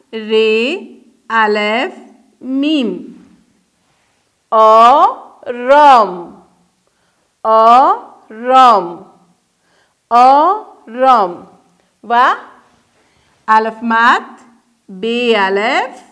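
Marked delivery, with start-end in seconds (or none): 4.5-11.5 drawn out and loud, split into syllables; هجا به هجا و آهسته; slow syllable-by-syllable. Shown as a demonstration. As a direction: model the word slowly for children. Teacher modelling syllables.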